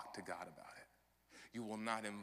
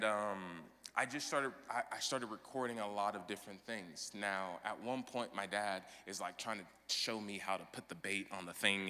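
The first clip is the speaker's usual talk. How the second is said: ordinary delivery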